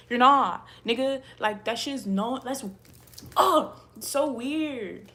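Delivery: loud, exasperated telling-off; mad. Real, not acted. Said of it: "it's just making me mad".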